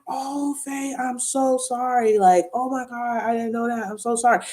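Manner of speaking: high-pitched mimicked condolence; sympathetic apology. Doctor hearing her mother passed away, sympathetic. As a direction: imitate a doctor full of sympathy.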